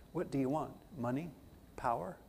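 soft, low imitation of a Southern accent. Teacher questioning his class about what they want, light Southern accent. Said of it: "in his lovely Southern accent".

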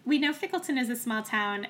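ordinary talk

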